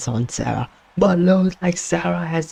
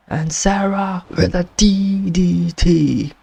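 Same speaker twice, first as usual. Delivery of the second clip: ordinary talk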